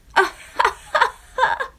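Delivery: high, loud jeering cackle; mocking laugh. The runners jeer at Jemima with a high laugh. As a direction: laugh at her unkindly. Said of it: "fell about laughing".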